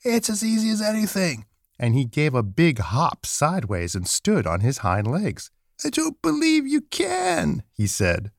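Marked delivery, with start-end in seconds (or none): 0.0-1.5 high wild-rabbit voice; furry rabbit. A narrator's reading. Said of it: "said the furry rabbit". 5.8-7.6 very high, drawn-out taunt; high-pitched doubting rabbit, slowly teasing. A narrator's reading. Wild rabbit taunts the toy rabbit.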